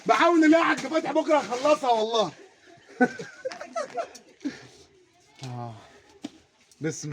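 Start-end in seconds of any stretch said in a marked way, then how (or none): 3.0-4.0 real high burst of laughter. Real, not acted. Laughing at Hajj Fathi's joke, high burst of laughter.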